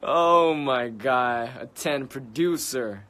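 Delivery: loud, slow, drawn-out oh my God; real shock, stretched and loud; excited disbelief at the news, drawn out; stunned excitement. Real, not acted. Reacting to shocking stream news.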